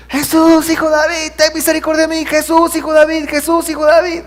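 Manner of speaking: high, loud, repeated desperate cry; a woman shouting after Jesus from behind the crowd. Retold or imitated. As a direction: call out to him like a mother at the end of her rope. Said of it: "una mujer gritando".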